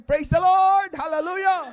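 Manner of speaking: full-voice high Hallelujah shout; shout of praise. Shown as a demonstration. Pastor leading the church in a loud shout. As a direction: shout it to the rafters. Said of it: "sumigaw nga tayong".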